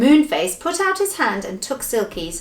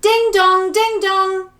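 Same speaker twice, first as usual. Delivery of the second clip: high, ringing ding-dong voice; loud, sustained high chimes; chiming clock; 11 semitones higher, 6 dB louder, slower than usual. Acted. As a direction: sing out like a striking clock. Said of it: "said Silky's clock".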